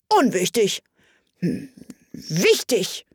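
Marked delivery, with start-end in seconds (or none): 1.4-3.0 wichtig slow, half aloud to himself; slow muttering. A narrator's reading. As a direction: mumble wichtig slowly, testing. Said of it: "mit halblauter Stimme für sich".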